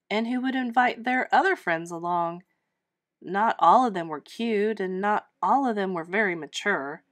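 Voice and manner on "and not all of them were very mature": ordinary delivery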